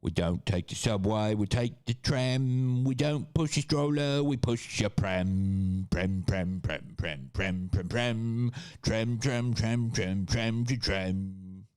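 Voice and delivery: British chant, stretching tram and pram; exaggerated British sing-song, degenerating into repetition; mock English accent. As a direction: hammer the words into a silly English refrain. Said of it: "That's basically England".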